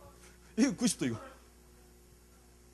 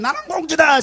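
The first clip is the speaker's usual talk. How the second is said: shouted proudly, higher; 8 semitones higher, 4 dB louder than usual. Shown as a demonstration. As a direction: proclaim it loudly. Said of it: "다시 한번".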